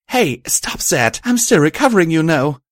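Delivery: high, loud squeal of protest; screamed protest. A narrator's reading. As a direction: squeal as if squeezed too hard. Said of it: "she screamed out as I hugged her close".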